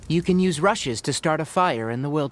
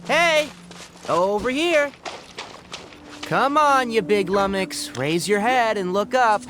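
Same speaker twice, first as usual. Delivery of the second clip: calling out; 8 semitones higher than usual. Acted. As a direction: shout up at the big lummox, cheerful and carrying. Calling out to a large creature.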